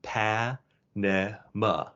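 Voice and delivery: Pa-ne-ma split into slow syllables with English vowels; slow syllable breakdown. Shown as a demonstration. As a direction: drag out each syllable of Pa-ne-ma. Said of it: "making three different sounds for each".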